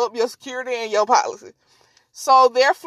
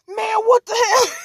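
high-pitched startled protest; mock pained outrage; 7 semitones higher than usual. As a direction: yelp it like the man just hit. Retold man hit by computer.

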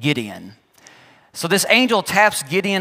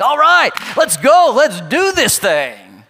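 higher and louder, pumped to go, then a slow drawn-out last phrase; eager go-getter; 5 semitones higher, 5 dB louder, slower than usual. Retold or imitated. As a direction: fire yourself up for the mission. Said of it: "I'd have been like".

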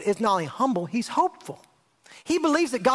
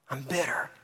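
bitter whispered right after being said plainly; a congregant admitting it in a whisper; whispered confession; 11 semitones lower, 9 dB quieter than usual. Retold or imitated. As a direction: drop bitter to a breathy whisper. Repeated confession now whispered.

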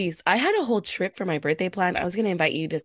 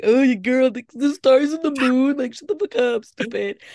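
higher, louder mock voice of the doubters; mocking scoffers; 5 semitones higher, 4 dB louder than usual. Imitating people who mock astrology talk, scornful. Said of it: "I know people are like".

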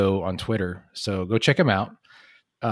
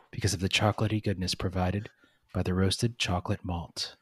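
softer, calm ASMR-style read; 4 dB quieter than usual. Shown as a demonstration. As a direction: read it gently like an ASMR video. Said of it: "A S M R voice".

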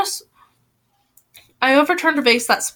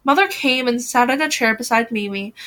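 ordinary delivery, nothing marked

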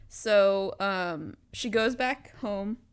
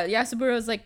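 normal talking voice

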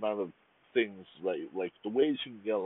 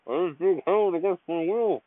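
hammed-up hostage cry, higher and louder; mock captive plea; 9 semitones higher, 8 dB louder, slower than usual. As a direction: play a fake kidnapping victim. Joking he is held captive.

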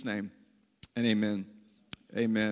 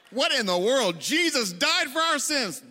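drawn-out, rising preacher's outcry; mock exasperation; 5 semitones higher, 3 dB louder, slower than usual. Preacher to his congregation, mock exasperated outcry. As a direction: exclaim it in exaggerated disbelief.

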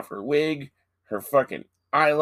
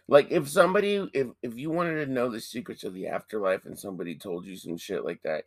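fast rant; 3 dB quieter, faster than usual. Real, not acted. Ranting disbelief.

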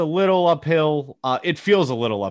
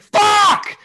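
mock golfer's yell, much higher and louder; imitating a scream; 11 dB louder than usual. Mimicking an angry golfer on the course, shouting. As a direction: bark it like a golfer who just shanked. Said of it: "just scream like".

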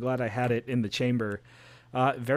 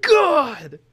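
frustrated cry; 12 semitones higher, 8 dB louder than usual. Real, not acted. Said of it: "friend steals his pick".

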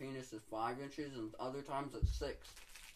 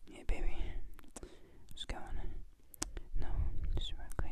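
whispered right into the mic; hushed, hammed-up come-on; mock seductive whisper; 9 dB louder than usual. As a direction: pretend secret sweet-talk in a whisper. Pretend intimate call, joking off-mic.

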